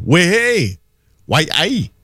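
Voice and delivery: light mock Geordie voice; Geordie accent. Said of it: "you would hear the Geordie accent".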